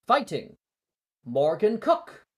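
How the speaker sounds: high, loud summons; announcer call. Acted. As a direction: call her to the stand for all to hear. Announcing opponent.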